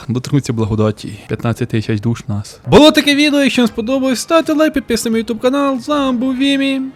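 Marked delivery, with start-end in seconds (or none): 2.7-6.8 high, bright-paced outro pitch; YouTube outro. Acted. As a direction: sign off the video like a host. Channel outro.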